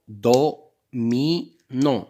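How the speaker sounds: DO, MI, NON as separate loud beats; syllable by syllable. Shown as a demonstration. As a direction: clap out "do, mi, non" one syllable at a time. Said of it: "on va frapper les syllabes".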